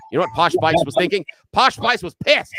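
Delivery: telling a friend the punchline, loud and emphatic. Real, not acted. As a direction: hammer home how angry she was. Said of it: "punchline emphasis".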